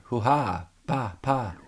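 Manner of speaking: soft, short martial-arts grunts; imitated fight noises. Imitating the turtles fighting in the dark, mock fight sounds. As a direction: mimic distant punches and kicks under your breath. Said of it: "You hear".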